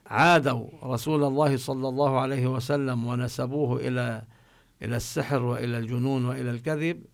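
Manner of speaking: normal talking voice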